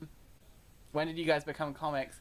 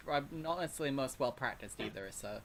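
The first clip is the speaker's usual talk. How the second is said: usual speaking voice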